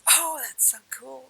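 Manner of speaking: high-pitched gush of wonder; thrilled little discovery, voice lifted; delighted surprise. Retold or imitated. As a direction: exclaim it with rising delight. Reacting to finding Isis on the art.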